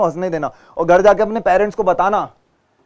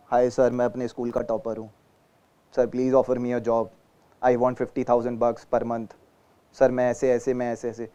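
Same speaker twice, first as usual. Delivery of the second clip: softer, timid job seeker mumbling; slouchy interview candidate; 5 semitones lower, 7 dB quieter than usual. Pretend. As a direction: play an unsure candidate, low energy. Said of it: "देखो मेरा posture". (ढीला, दबा हुआ उम्मीदवार)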